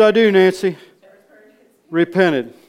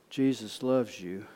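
soft, slow, lowered voice; tender bedside words; 4 semitones lower, 8 dB quieter, slower than usual. Retold or imitated. Preacher speaks to the boy in bed, soft and tender. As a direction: say it gently and unhurried.

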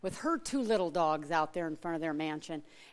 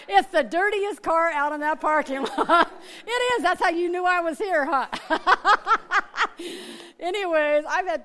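high, loud words riding a real laugh; 12 semitones higher, 12 dB louder than usual. Real, not acted. Teasing the congregation about her dirty car, laughing out loud. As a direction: crack up at your own dirty car.

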